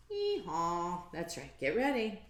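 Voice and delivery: ordinary delivery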